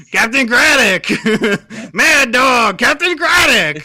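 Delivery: loud pirate shouts, laughing between; shouting pirate voices. Acted. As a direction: bellow both names, cracking up. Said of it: "Mad Dog's voice was, like, basically the same".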